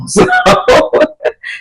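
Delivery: high breathy burst of laughter. Real, not acted. Storyteller cracks up at the absurd quarrel, laughing. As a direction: crack up at your own story.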